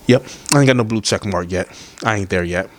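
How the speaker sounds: ordinary talk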